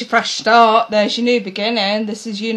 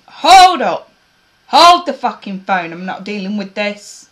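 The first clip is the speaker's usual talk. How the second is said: loud exclamation; 7 dB louder than usual. Retold or imitated. Viewers shutting down returning ex.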